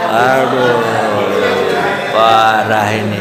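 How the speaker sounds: slow, drawn-out groan of dismay; low, slow, lamenting exclamation. Acted. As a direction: stretch each word out in mock dismay. Dismayed reaction to audience question.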